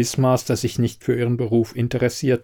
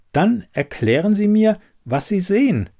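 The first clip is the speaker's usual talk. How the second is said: high-pitched, pointed challenge; challenging demand; 9 semitones higher than usual. Acted. Elida challenging the quizmaster to look, insistent.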